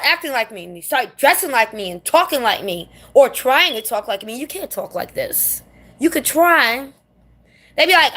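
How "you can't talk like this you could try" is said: ordinary delivery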